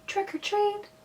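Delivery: tiny, high, hushed voice; very soft. A narrator's reading. Lance asks the fallen lady for candy, very softly. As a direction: barely voice it, up high. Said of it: "so he said very softly".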